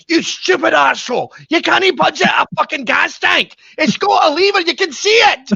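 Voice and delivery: high-pitched screeching scolding; hammed-up, shrieking marital tirade; furious mother impression. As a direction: imitate mom tearing into her husband. Mother screaming at dad over gas.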